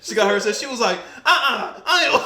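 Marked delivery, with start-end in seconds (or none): 1.2-2.2 high, loud imitation of a customer refusing; mock objection. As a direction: protest like an offended customer, through laughter. Imitates customer objecting to wrong order.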